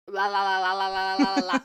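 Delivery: loud monotone la-la-la chant; rapid babbled la-la, fingers-in-ears style; blocking-out la-la-la. Shown as a demonstration. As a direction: drown out the TV with la-la-la. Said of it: "like, literally, like".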